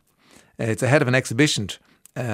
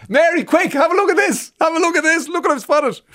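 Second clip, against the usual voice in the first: very high, louder, thrilled shout to a colleague; excited call; 4 dB louder than usual. Pretend. Imagined restorer calling Mary over to see the find, excited high shout. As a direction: squeal it out like you can't believe your eyes.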